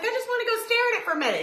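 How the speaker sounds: squeaky high pleading, fast and laughing; whiny plea. Retold or imitated. To husband on the couch, a squeaky laughing plea. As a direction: beg like an eager kid. Begging husband to see pool.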